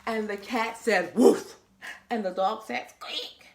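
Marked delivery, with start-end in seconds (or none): 1.1-1.6 loud, short woof; barking cat. Acted. Animal sound in story. 2.9-3.5 soft, voiceless little squeak; squeaking dog. Acted. Animal sound in story.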